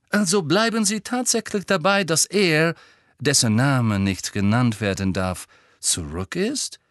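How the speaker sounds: lightly voiced probing reporter, starting high; a touch of incredulous interviewer; sceptical reporter. A narrator's reading. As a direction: press the question, slowing on the last words.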